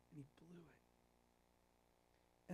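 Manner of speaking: slow, breathy whispered lament; sorrowful whisper. Real, not acted. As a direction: let it fall to a slow, grieving whisper. Preacher lamenting Peter's failure.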